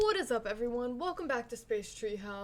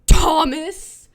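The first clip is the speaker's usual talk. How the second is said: very loud, high burst on the name; sudden shout; 5 semitones higher, 21 dB louder than usual. Acted. Girl texting thanks to the boyfriend, sudden loud shout. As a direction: blurt the name out at full volume. Acting out the girl's text.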